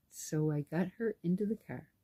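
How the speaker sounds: ordinary delivery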